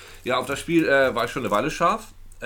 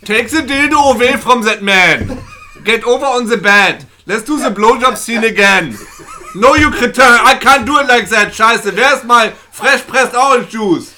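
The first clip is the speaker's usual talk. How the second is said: loud, high, slow shouting director; porn director; 10 semitones higher, 16 dB louder than usual. Pretend. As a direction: bark orders as a diva director. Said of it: "Ich wollte gerne den deutschstämmigen Porno".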